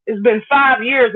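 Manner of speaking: ordinary talk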